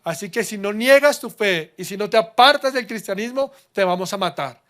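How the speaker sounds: loud, furious royal threat; king ordering the execution, raging; enraged king. Acted. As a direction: roar the death sentence. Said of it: "El rey ya iracundo".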